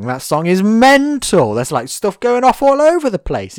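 high, slow, emphatic enthusiasm; high excited exclamation; excited rave. Real, not acted. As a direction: gush about how wild the song is. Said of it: "excited rave about song".

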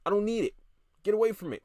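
normal talking voice

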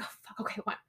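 grudging, whispered give-in; begrudging whisper. Retold or imitated. Veronica grudgingly giving in at the pawn shop, whispered. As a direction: mutter it under your breath, giving in. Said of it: "begrudgingly like".

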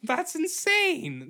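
shocked drawn-out exclaim; high amazed. Real, not acted. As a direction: stretch it out in disbelief. Repeated exclamation.

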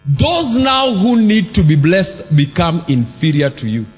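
ordinary talk, nothing marked

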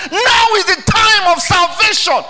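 high, loud proclamation; preaching shout. Acted. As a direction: ring it out like an altar call. Preacher climaxes on salvation.

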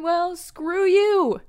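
high, loud, slow defiant outburst. Retold or imitated. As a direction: fling it at her. Acting out Nia's defiance.

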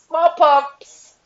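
big laughing yell of the word; gleeful shout. Real, not acted. Laughing at her own word slip.

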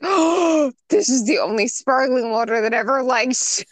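high, gushing delight; gasp, then gush about it; excited. Retold or imitated. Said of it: "I was like".

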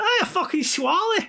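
very high, drawn-out Scottish cry; broad Scottish accent. Retold or imitated. [name] repeating himself to the Canadian, exaggerated Scottish accent. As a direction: insist in hammed-up Scottish. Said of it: "Kevin Bridges type Scottish".

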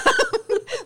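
very high delighted squeal of laughter; giddy squealing laugh. Real, not acted. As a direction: squeal like a smitten fan. Giddy over shirtless scene.

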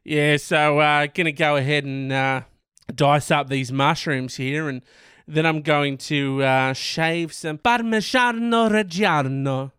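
casual, slow chat turning heavily Italian on Parmigiano Reggiano; caked-on Italian accent. Acted. Mimicking cooks dicing mushrooms, Italian accent on the cheese. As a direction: talk normally, then ham up an Italian accent. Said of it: "talking normally and they're like".

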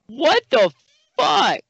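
high, loud stunned outrage; outraged disbelief. Real, not acted. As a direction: spit it out in shock. Reacting to rude comments.

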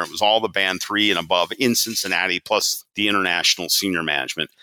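ordinary talk, nothing marked

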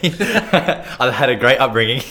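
laughing through the words, slow and loud. Real, not acted. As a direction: laugh it out while defending family. Laughing while insisting.

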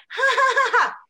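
high, loud put-on "ja, ja" cackle; a mocking staged laugh, cut off dry; fake loud laugh. To two guys laughing at her fallen friend, a loud fake laugh.

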